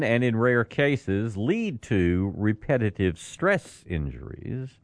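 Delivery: slow, measured news read; slow newsreader delivery. Acted. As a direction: read the item out deliberately. Reads the news story.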